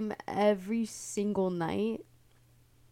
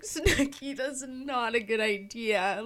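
higher, louder, slow pleading; mock earnest plea; 4 semitones higher, 4 dB louder, slower than usual. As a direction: beg a friend to stop. Stopping Snooki cheating.

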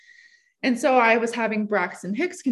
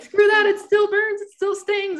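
high, louder pained complaint; mock protest; 12 semitones higher, 4 dB louder, slower than usual. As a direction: whine it out like it still burns. Complaining about needle burn.

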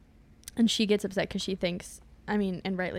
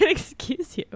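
higher, louder mock-offended excuse you; louder indignant repeat; 7 semitones higher, 4 dB louder, slower than usual. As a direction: say it again, playfully offended. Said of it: "I said".

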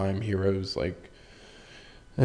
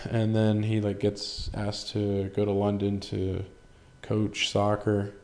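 ordinary talk, nothing marked

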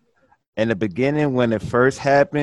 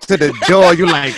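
usual speaking voice

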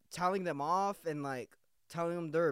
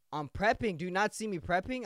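louder, emphatic retort to a nagging boss; exasperated tone; 3 semitones higher, 4 dB louder than usual. Retold or imitated. Employee telling manager they're prepping, exasperated retort. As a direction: push back with mock exasperation, louder. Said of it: "I literally had that tone".

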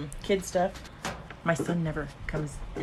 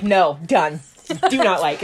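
mock curt verdict; 10 dB louder, slower than usual. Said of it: "and you're just like".

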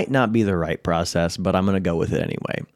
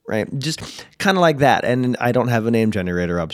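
ordinary talk

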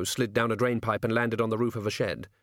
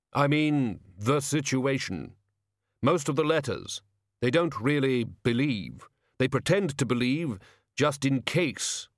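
slow, ponderous figure's voice; grave and deliberate; solemn hooded figure; 3 semitones higher, slower than usual. Acted. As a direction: weigh out each phrase with long pauses.

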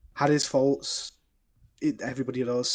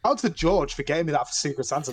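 normal talking voice

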